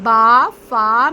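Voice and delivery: बा, फा pressed from the lips, slow and loud; बा and फा with a clear lip release; lip letters. Shown as a demonstration. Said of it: "होंठों से अदा करते हैं".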